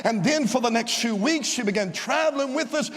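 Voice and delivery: ordinary delivery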